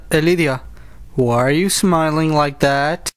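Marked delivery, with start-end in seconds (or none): none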